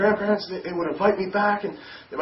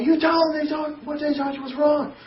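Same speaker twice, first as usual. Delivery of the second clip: much higher and louder demand; retold yell; 10 semitones higher, 4 dB louder than usual. Brother yelling at him before the grandparents, high loud retold yell. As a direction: yell it as the furious older brother. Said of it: "He yelled".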